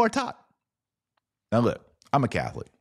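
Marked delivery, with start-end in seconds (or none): none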